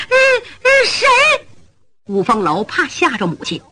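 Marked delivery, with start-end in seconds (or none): 0.1-1.1 high frightened whimper; a scared old lady asking who; mother afraid, asking who is there. Acted. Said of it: "五夫人吓坏了".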